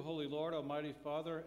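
usual speaking voice

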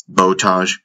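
ordinary delivery, nothing marked

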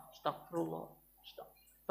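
softly breathed repentance, almost silent; whispered istighfar. Shown as a demonstration. Preacher demonstrates quiet night prayer, whispering. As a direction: murmur it under the breath. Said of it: "istighfar yang banyak".

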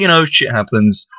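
normal talking voice

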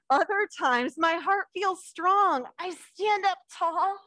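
slow, lifted storybook voice; reading to children. Acted. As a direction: draw the little ones into the story. Reads picture book to children.

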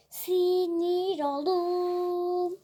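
very slow, stretched sinir oldum; drawn-out whine. Pretend. Gamer to viewers, hungerless and annoyed, drawn-out whine.

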